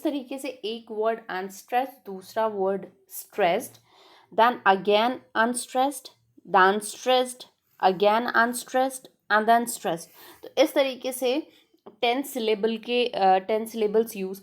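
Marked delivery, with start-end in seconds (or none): 4.4-10.1 slow, louder unstressed-stressed beat; rhythm demo. Shown as a demonstration. Teacher showing students the iambic rhythm, slow and emphatic. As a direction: tap out the iambic beat, each word slow and spaced. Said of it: "दूसरा letter that would be stressed".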